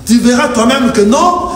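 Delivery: ordinary delivery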